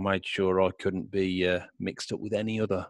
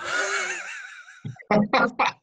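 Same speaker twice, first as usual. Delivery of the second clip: shrieking laugh; big laugh. Real, not acted. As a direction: burst out laughing at yourself. Laughs at own joke.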